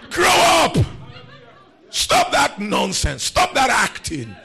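loud, slow, high shouted preaching; preacher thunders to the congregation, slow and loud; full-force preaching. Acted. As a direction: rebuke then reassure, loud and slow.

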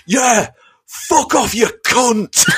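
slow, high hammed-up heckle; mock English heckler. English dad heckling Booker T, slow mocking insult. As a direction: drag it out like a bloke at the football. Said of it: "this English guy ... went".

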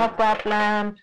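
high-pitched coaxing masseuse voice; massage worker. Retold or imitated. Masseuses luring a passer-by inside, high mimicked voice. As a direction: put on a high, wheedling sales pitch. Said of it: "they're like".

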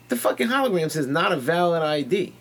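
high-pitched indignant complaint, retold; mock outrage, voice pushed high; retold argument. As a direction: act out protesting to the seller. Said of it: "as I'm arguing with this lady".

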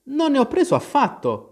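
high-pitched, huffy retort; indignant storybook Alice; offended. A narrator's reading. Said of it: "replicò Alice con tono offeso".

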